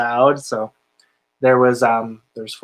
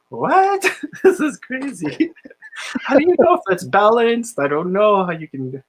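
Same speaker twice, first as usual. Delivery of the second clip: high, laughing disbelief at the odd roulette; amazed disbelief; 9 semitones higher than usual. Retold or imitated. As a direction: retell the shock, laughing, much higher. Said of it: "I was like".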